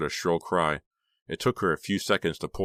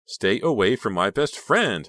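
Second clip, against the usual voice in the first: furious; 5 semitones higher, 6 dB louder than usual. A narrator's reading. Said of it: "twisted in ugly fury".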